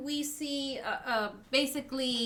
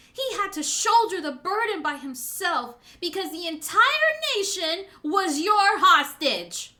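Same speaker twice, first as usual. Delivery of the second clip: impassioned speech, building louder toward the end; passionate; 5 dB louder than usual. Acted. Daughter recounting father's suffering to his betrayer, passionate. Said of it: "You were very passionate there".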